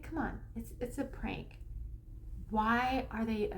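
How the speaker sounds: usual speaking voice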